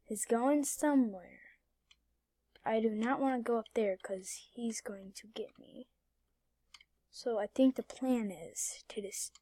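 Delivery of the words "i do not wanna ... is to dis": whisper. Real, not acted. Hiding from a cop in game.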